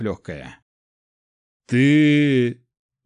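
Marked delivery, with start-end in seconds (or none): 1.7-2.6 a gasping loud cry through blood; choking on blood, forcing out the word. A narrator's reading. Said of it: "вздохнул герцог, захлёбываясь кровью".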